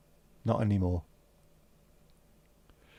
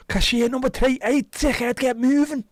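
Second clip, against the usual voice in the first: mock yell; 14 semitones higher, 8 dB louder than usual. Impatient shop caller yelling.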